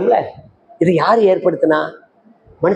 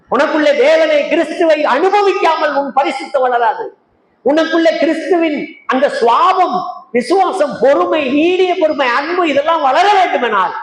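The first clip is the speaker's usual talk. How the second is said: high-pitched, driving sermon delivery; impassioned preaching; 9 semitones higher than usual. Acted. Preacher challenging saved souls on why the church has not grown, impassioned. Preaches urgently on inner growth.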